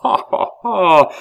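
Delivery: loud, high stage laugh; disdainful menacing laugh. A narrator's reading. Abbé laughs in Pitou's face, disdainful menace. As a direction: laugh scornfully, swelling on the last "ha". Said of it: "L'abbé se mit à rire, d'un rire tellement plein de dédaigneuses menaces".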